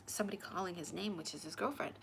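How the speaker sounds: ordinary delivery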